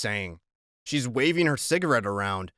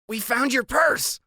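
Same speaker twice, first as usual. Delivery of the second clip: put-on nervous boy blurting; 11 semitones higher than usual.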